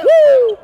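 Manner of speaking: high loud whoop; excited whoop. Real, not acted. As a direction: whoop in celebration.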